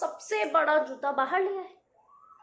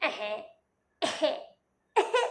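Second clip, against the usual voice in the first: little sobbing hiccups; crying sobs. A narrator's reading. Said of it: "रोते वक्त गोल मटोल राम की आवाज थोड़ी बदल जाती थी".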